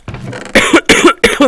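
loud raspy coughs; sharp coughing fit. Real, not acted. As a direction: cough hard into the mic. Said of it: "More coughing".

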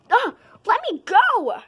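high shrill struggle; panicked yell. Acted. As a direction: cry out while held. Grabbed during lunch fight.

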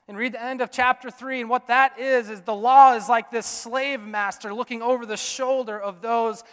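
loud, high, ringing pulpit voice; full-force preaching, high and loud. Acted. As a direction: preach it out over the whole room.

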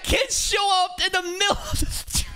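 very high, slow, incredulous yell; shocked shout. Real, not acted. Viewer shocked the kid shows up, shouting in disbelief. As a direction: cry out in disbelief.

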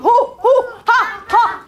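high, loud cackling chant; witch cackle. Acted. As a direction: cackle over the pot. Witch stirring again, laughing.

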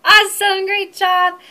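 loud, high drum-roll hype; loud, bouncy countdown to stop the song; excited countdown. Acted. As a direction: pump the kids up for the big stop.

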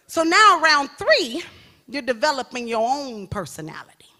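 usual speaking voice

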